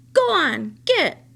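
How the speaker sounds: high, loud shout at the crow. Acted. Boy shouting to chase a crow away. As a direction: shoo the bird off with a yell. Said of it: "flapped his arms and shouted".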